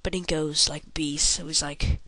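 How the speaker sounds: ordinary delivery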